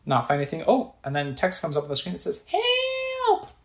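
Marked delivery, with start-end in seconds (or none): 2.5-3.5 a tiny, very high call of "Help!"; high cry for help. Acted. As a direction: squeak it out like a desperate game character.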